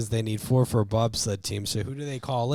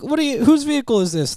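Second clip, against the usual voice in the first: high, louder startled challenge from a cop; surprised cop; 13 semitones higher, 5 dB louder than usual. Retold or imitated. Police stop the speeding bobsledders, high, loud, startled.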